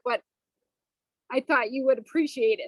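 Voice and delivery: usual speaking voice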